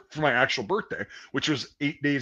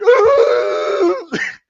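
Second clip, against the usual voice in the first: high far howl of a man losing it; imitated panicked scream; 9 dB louder than usual. Buddy screaming in the gas chamber, mock howl. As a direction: scream like a gassed recruit. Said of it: "he's just like".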